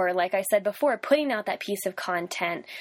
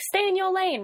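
much higher put-on voice; mock driver's muttering; 11 semitones higher than usual. Driver to whoever drifts into her lane, high mock muttering. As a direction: imitate yourself griping at a drifting driver, high-pitched. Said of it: "I say it in that voice too".

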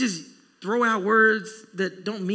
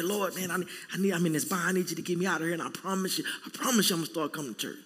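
bargaining prayer; 5 semitones lower, 9 dB quieter, faster than usual. Retold or imitated.

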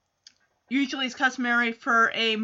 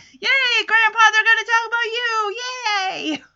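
excited cheer; 9 semitones higher, 4 dB louder than usual. Retold or imitated. Voicing Ricky cheering grandpa on.

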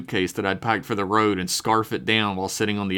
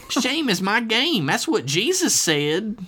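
high, slow sanctimonious mother; drawn-out, high-pitched righteousness; scolding mother; 12 semitones higher, slower than usual. A narrator's reading. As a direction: declare it piously in a put-on female voice. Pious mother character scolds her son.

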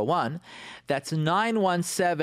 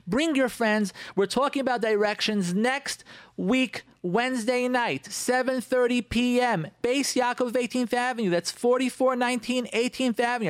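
announcer voice; 9 semitones higher than usual. Acted. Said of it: "I sound like a, like an announcer here".

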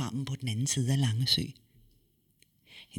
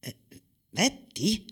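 higher, puzzled question; astonished; 7 semitones higher, faster than usual. Acted. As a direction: stumble on a surprise. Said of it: "sagde hun forbløffet". (forbløffet spørgsmål)

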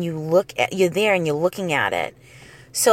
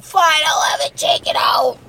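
imitating little brother; 9 semitones higher than usual. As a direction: give in like a sobbing little boy. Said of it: "He's like".

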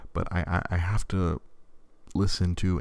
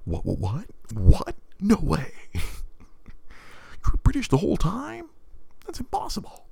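climbing much higher and slower into squeaky disbelief; a costar who cannot believe his ears; incredulous John Cena; 12 semitones higher, slower than usual. Retold or imitated. Co-star learning the actor is British, squealing in disbelief. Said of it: "incredulous John Cena going".

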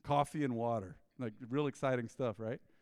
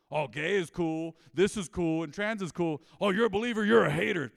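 mocking impression; 3 semitones higher, 8 dB louder than usual. Mocking the world's attitude.

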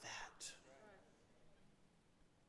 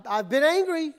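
ordinary delivery

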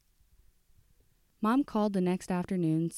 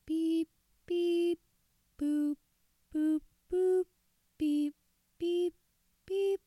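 very high sung dial beeps; imitated phone tones; 12 semitones higher, 4 dB louder, much slower than usual. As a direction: chime the keypad tones like a phone. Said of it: "her mind played the tones back".

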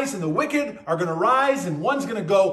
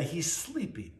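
softer, almost under the breath; hushed; 2 semitones lower, 11 dB quieter than usual. Retold or imitated. Jesus tells of Lazarus who has died, hushed. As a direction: let it fall away gently, hushed.

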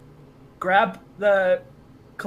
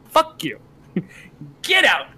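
staff's silent fury; 4 dB louder than usual. Retold or imitated. Voicing staff's reply to shoppers.

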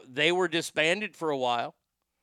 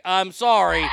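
shouted apology; 3 semitones higher, 8 dB louder than usual. Real, not acted. Host objecting to the movie casting.

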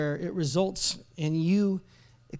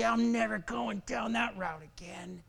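slow, soft acted resolve; hushed, drawn-out vow of someone hurt; quiet bitter vow; 4 semitones higher, 5 dB quieter, slower than usual. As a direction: say it slowly, under the voice. Quoted private vow of a cynic.

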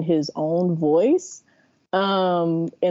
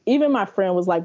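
ordinary talk

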